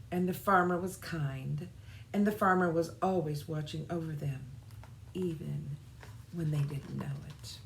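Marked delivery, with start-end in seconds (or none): 5.1-7.6 hushed whispered ending. A narrator's reading. Narrator telling children of the watching farmer, whispering. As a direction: let it fall to a whisper.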